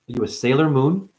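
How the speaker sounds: ordinary talk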